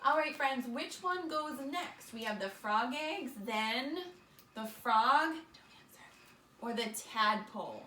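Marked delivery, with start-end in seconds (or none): 5.3-6.6 whisper. Real, not acted. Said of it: "teacher hushes quiz reminder".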